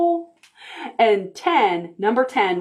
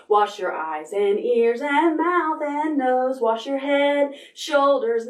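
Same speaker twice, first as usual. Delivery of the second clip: quicker, brisker sing-song; faster song. Pretend. Parent singing faster for the child, brisker. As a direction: pick up the tempo. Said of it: "Do you think you could do it faster?".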